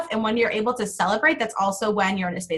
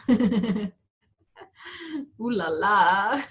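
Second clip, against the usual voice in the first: sexy man voice; much slower than usual. Pretend. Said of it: "a very sexy man voice".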